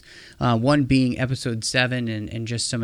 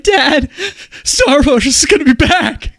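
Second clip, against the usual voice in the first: much higher and louder, laughing through fake man tears; mock teary fan; 14 semitones higher, 11 dB louder than usual. Host teasing guest calling his dad, mock blubbering. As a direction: ham up a weepy overjoyed son. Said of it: "got man tears".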